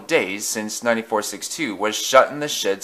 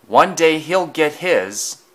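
put-on indignant young engine; 6 semitones higher, 4 dB louder, slower than usual. Thomas vows revenge.

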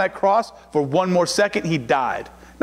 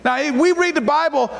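usual speaking voice

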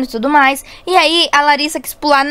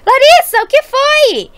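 high-pitched call over distance; shouting; 9 semitones higher, 4 dB louder, slower than usual. Acted. Girl asking if her sister needs rescuing, shouting.

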